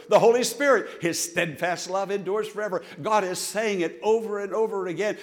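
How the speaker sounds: rhythmic preaching, punching each name of the Trinity. Real, not acted. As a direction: drive the repeated refrain home to the congregation. Preaches with building repetition.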